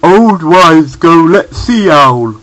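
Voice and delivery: slow, scrambled speech; muddled words. A narrator's reading. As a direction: say it like a brainless bear. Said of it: "no one understood what he meant".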